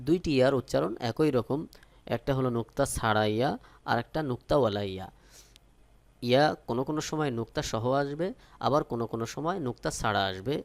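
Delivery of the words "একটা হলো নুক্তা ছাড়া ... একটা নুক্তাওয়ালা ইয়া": ordinary talk, nothing marked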